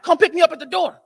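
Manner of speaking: calling out. Retold or imitated. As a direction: call out to her across the parking lot.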